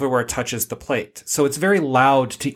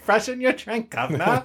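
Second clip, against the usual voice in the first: a much higher hammed-up cockney accent with laughter; mock British accent; 10 semitones higher than usual. Mocking an Australian accent, she drifts into cockney, laughing. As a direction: slip into a cartoon London accent, laughing. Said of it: "accent to offend our Australian audience".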